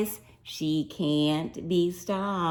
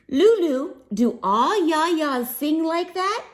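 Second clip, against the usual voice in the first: put-on higher, puzzled child voice; curious friend; 8 semitones higher than usual. A narrator's reading. Friend asking Lulu about her grandma, higher and curious. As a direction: ask it with wide-eyed wonder. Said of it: "Juby asked me".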